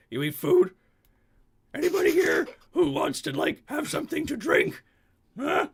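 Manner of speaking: high, loud cartoon barmaid; troll barmaid voice. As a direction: take orders like a blunt troll. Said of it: "it's just like".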